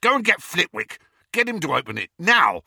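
loud, high impatient command; rough-tempered yelling; shouted order. A narrator's reading. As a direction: shout orders, ending on a burst. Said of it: "shouted Amycus".

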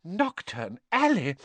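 high, appalled mother's cry; horrified exclamation. A narrator's reading. Missus Weasley thanks Hagrid after the scare, horrified. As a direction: exclaim it in horror, pitched high.

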